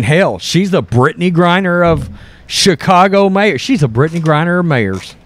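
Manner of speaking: ordinary talk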